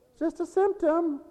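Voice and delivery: high mock preacher voice; mocking other preachers. Mocking preachers who call it just a symptom, high mock quote. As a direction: lampoon the false teaching. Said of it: "That's the kind of preaching".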